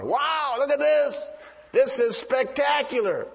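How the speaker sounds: high-pitched imitated amazement; excited admiration. Fishermen from Galilee gazing at the temple instead of listening, excited admiration. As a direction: play a wowed sightseer, voice lifted. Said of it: "It was excited admiration".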